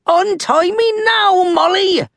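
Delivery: high, indignant pirate demand; fuming. A narrator's reading. Tied-up Captain Red to Molly, fuming high. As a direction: fume and demand release. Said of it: "Red fumed".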